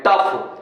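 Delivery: टफ ending in an F sound. Shown as a demonstration. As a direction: say टफ with final GH pronounced "f". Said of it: "जी एच को फा पढ़ रहे हैं". (टफ, अंत में फ़ की आवाज़)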